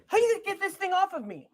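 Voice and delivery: high-pitched complaint. Acted. Acted plea to remove a thing.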